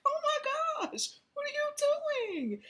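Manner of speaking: high, slow, incredulous; mock dismay. Watching herself snort, high slow dismay. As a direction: gasp at yourself in disbelief.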